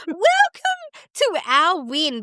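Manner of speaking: high, slow, giggly proclamation; playful, stretched-out high greeting with a laugh; laughing welcome. Real, not acted. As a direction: welcome them grandly through laughter. Laughing, jokingly welcoming listeners.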